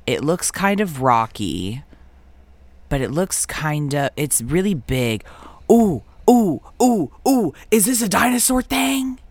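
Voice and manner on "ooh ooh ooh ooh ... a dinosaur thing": high excited ooh, ooh; excited squeals. Real, not acted. Excited guessing the picture.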